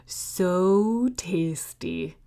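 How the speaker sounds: slow relishing whisper. Acted. Wolf patting his belly, slow whisper. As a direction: draw it out in a hush.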